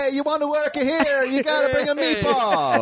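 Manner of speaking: high, hammed-up Italian accent; mock Italian accent. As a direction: squeal it like a cartoon Italian club boss. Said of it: "Manny was like".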